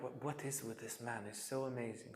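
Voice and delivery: soft, wondering awe; hushed awe. Retold or imitated. Polly marvelling at Gudri Baba, hushed and wondering. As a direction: marvel at him under your breath.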